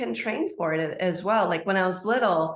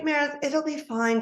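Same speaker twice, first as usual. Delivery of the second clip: higher, lightly voiced mother soothing; mom soothing; 8 semitones higher than usual. Mother on the phone telling her daughter not to worry, higher soothing voice. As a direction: play mom brushing off her worry, pitched up. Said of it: "But she's like".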